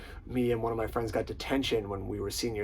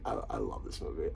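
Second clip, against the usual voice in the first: normal talking voice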